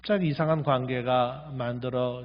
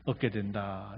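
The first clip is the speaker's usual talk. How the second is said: lightly voiced God, higher and slow; voicing God; 6 semitones higher than usual. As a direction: quote God's words with a hint of gravity. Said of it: "이렇게 또 하나님 말씀하시거든요". (하나님 말씀을 살짝 흉내 내어)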